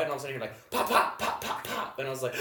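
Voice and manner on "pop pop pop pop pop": high, breathy pop pop gunfire noises; imitated gunshots. Telling friends about hearing gunshots, voiced pops. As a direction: mimic distant gunshots with your mouth.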